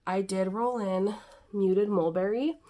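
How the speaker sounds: usual speaking voice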